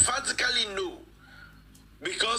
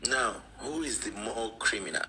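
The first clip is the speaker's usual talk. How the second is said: ordinary talk, nothing marked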